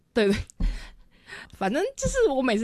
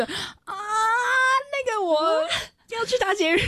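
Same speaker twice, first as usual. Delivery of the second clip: high slow panicky cry; panicked excuse; 13 semitones higher, slower than usual. Retold or imitated. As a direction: wail out a nervous excuse.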